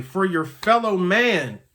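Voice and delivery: ordinary talk, nothing marked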